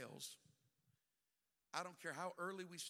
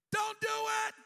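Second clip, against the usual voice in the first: panicked shout; 14 dB louder than usual. Acted. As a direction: yell in alarm. Friend reaching for stuffed closet.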